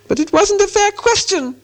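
very high creature protest; Gollum voice. A narrator's reading. Gollum disputes the riddle game, whining high.

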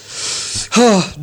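high, loud grudging sigh; the master's annoyed huff; disgruntled sigh. A narrator's reading. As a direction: let out a sour sigh before objecting. Master grinding his teeth.

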